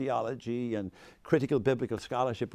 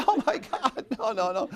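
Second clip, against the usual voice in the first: high-pitched mock dismay; imitated student protest; 7 semitones higher than usual. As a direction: act out students objecting, starting high. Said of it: "And they say".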